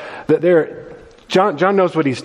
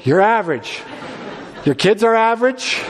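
mock blunt pastor; 5 semitones higher than usual. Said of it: "not to tell your people".

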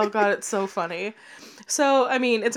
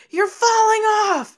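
high, loud panicked cry; girls yelling warning; 12 semitones higher, 5 dB louder than usual. Retold or imitated. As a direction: shout it like the girls on the runaway carriage. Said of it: "the girls are like".

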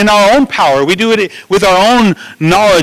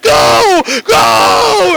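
frantic shout; 12 semitones higher than usual. Retold or imitated. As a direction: yell your kid off the starting line. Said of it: "They're like".

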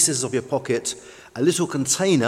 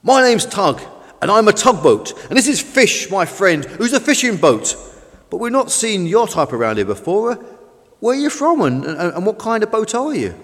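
higher, louder, chatty boat voice; friendly, eager tugboat; 6 semitones higher, 5 dB louder than usual. Acted. Tug introduces himself and Fish to the new boat, eager. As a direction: introduce yourself eagerly to a newcomer.

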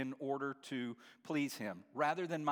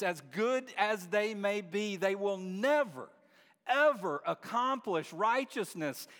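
louder preaching; 5 semitones higher, 5 dB louder than usual. Real, not acted. Preacher to church, louder emphatic proclamation. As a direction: hammer it home to the congregation. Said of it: "Do I need to say that louder?".

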